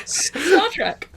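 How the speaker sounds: laughing through the words. Real, not acted. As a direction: say it while cracking up. Correcting with a laugh.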